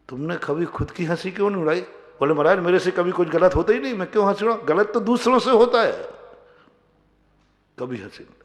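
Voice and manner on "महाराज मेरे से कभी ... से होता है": high, fast, self-satisfied protest; proud disciple. Retold or imitated. Disciple answers the guru in a high, fast, smug protest. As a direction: rattle it off sure you never err.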